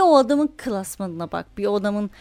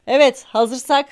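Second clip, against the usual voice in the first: ordinary talk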